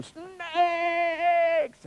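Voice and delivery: very high, drawn-out scream; long comic shriek, loud and high; mock terrified yell. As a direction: imitate a panicked man shrieking. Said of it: "he's yelling".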